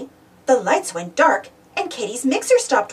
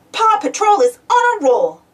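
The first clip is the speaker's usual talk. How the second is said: bright cheering shout, higher and louder; 7 semitones higher, 5 dB louder than usual. Acted. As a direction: call it out like a team rallying cry. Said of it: "Ryder shouted".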